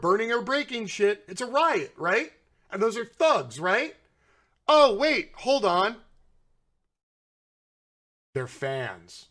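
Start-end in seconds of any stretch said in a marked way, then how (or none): 4.7-6.0 a loud, hammed-up oh wait, then a quick catch; mock realization. Mocking the right-wing media, a sarcastic fake realization. 8.3-9.3 low, soft, dripping with sarcasm; sarcastic aside. Pretend. Correcting himself for right-wing media, low and sarcastic.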